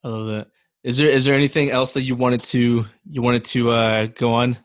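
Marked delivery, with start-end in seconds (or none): none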